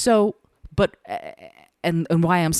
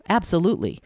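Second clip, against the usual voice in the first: ordinary delivery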